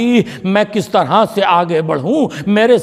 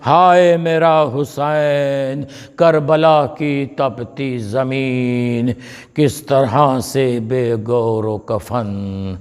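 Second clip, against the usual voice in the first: grieving lament; 6 semitones lower, much slower than usual. Acted. Fatima laments Husain in sermon.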